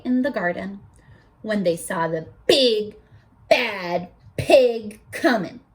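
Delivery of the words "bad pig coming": ordinary delivery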